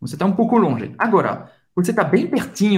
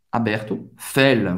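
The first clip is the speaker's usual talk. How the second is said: normal talking voice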